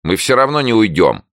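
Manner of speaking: high, loud refusal; loud defiance. A narrator's reading. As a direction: stand your ground loudly. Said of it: "согласилась Таня громко". (громко и упрямо)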